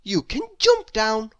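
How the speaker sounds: brightly offering the answer; Miss Brainy voice, lighter. A narrator's reading. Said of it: "said little Miss Brainy".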